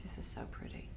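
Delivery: hushed awe; whispering. Real, not acted. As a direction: whisper it softly. Admiring the piece up close.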